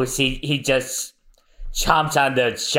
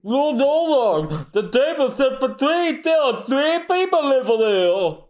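sneering henchman; 12 semitones higher than usual. Acted. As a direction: play a fawning pirate lackey. Henchman reports to the boss.

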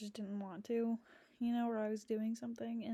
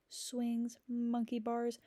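normal talking voice

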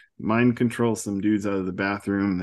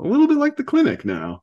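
squeaky joke voice; 4 dB louder, faster than usual. Acted. Joking aside.

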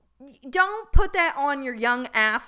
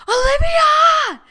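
retelling the ex's high, loud, drawn-out scream of her name; imitating his scream; 13 dB louder, much slower than usual. Drunk ex in the rainy street, a long high scream of her name. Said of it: "Screaming my name in the middle of the neighborhood".

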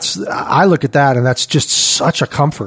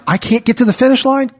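high-pitched acted complaint; imitated plea, pitched well up; mock protest; 10 semitones higher, faster than usual. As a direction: pipe up as a doubter protesting. Acts out a discouraged believer's protest.

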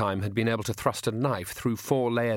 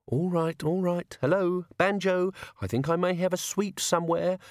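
much higher, careful voice; placating; 8 semitones higher than usual. Acted. Newcomer pacifies big Banjo with a sweet, placating high voice. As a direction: humour him as you would a child.

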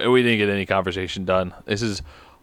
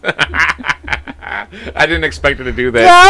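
laughing; 4 semitones higher, 5 dB louder, much slower than usual. Real, not acted. Dad laughing at stunt.

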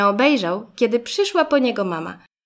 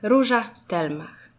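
ordinary delivery

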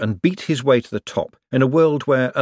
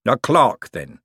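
dismissive; 3 semitones higher, 4 dB louder, slower than usual. A narrator's reading. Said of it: "said Harry King dismissively".

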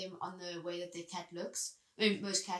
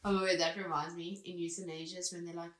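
ordinary talk

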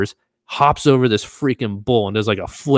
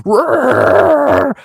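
high, loud bellowing bull roar; bull scream; 11 dB louder than usual. Retold or imitated. Narrator imitating the calf's scream in the ring, high and loud. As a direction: let out the calf's huge scream.

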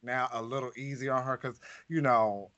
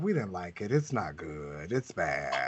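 dropping lower and lower, deadpan; blunt verdict; 8 semitones lower, 3 dB quieter than usual. Retold or imitated. Guest telling the producer the show was bad, deep deadpan. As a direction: sink each word flatter and lower. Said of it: "we were like".

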